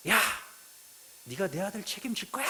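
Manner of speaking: a half-acted furious shout; retold angry yell. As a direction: imitate a father shouting down the phone. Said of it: "소리를 꽉 지르는 거예요".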